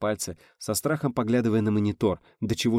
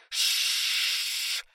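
long steady voiceless shhh; air hissing out. Acted. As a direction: imitate air escaping through a hole. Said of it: "воздух зашипел". (шипение уходящего воздуха)

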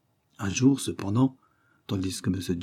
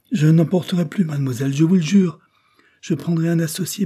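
higher, louder frantic promise; a lover's panicked vow, much higher; desperate pleading; 8 semitones higher, 6 dB louder than usual. Acted. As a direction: beg her not to leave, frantically. Desperate suitor begging.

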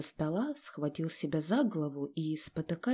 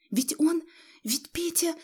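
high, halting timid plea; nervous murmur; 10 semitones higher than usual. A narrator's reading. Wife defending her son to her husband, timid stammer. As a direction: falter in a high, fearful voice. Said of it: "пробормотала Федосья Семёновна, нервно шевеля пальцами".

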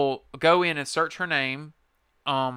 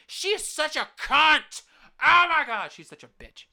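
disgusted venting; 13 semitones higher, 4 dB louder than usual. Real, not acted. Venting about the woman.

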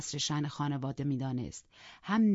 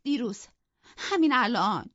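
sobbing; 6 semitones higher, 6 dB louder than usual. Acted. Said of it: "حق حق کنان گفت".

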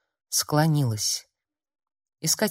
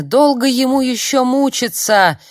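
very loud, high, strained shout; anguished cry; 9 semitones higher, 9 dB louder than usual. A narrator's reading. Friend's remembered scream pursues the fleeing girl, anguished. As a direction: cry it out with all your anguish. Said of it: "а надрывный крик Лисаны".

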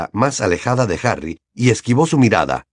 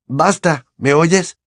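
bursting out; 7 semitones higher, slower than usual. A narrator's reading. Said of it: "estalló Harry".

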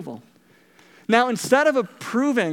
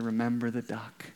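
hushed, low threat in his ear; menacing whisper; 7 semitones lower, 8 dB quieter than usual. Retold or imitated. Sister threatening her brother in his ear, a low hushed menace. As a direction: lean in and breathe the threat softly. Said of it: "leaned over in Johnny's ear and said".

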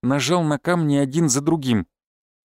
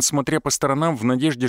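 ordinary delivery, nothing marked

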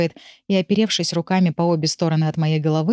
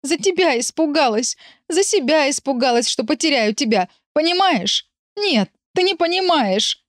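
hysterical crying; 8 semitones higher than usual. Acted. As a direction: wail it in hysterics. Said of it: "вместе с криком".

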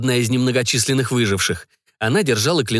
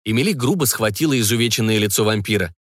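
usual speaking voice

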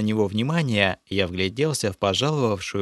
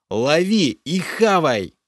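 angry order; 7 semitones higher, 5 dB louder, slower than usual. A narrator's reading. Hero yells at creature.

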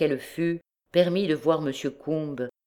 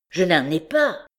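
high, anxious denial; anxious protest; 4 semitones higher, 4 dB louder than usual. A narrator's reading. Robbed man tells the bandit he has none, anxious protest. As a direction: protest it with raised pitch.

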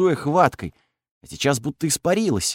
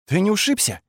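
higher, sweetly innocent question; innocent girl voice; 9 semitones higher than usual. Acted. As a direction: ask with fake innocence. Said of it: "делая невинное лицо".

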